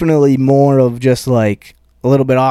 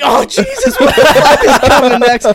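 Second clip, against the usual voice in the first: high-pitched imitation of dismayed audience; mock appalled crowd; 11 semitones higher, 5 dB louder than usual. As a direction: react as a scandalized crowd, pitched way up. Imitating appalled crowd reacting to joke.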